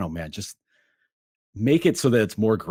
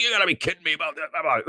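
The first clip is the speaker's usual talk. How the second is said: fast, higher, mock-exasperated coach yelling at the ref; retold angry yelling; 6 semitones higher, much faster than usual. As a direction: rattle it off like a furious coach, half-imitated. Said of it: "he's screaming at the ref".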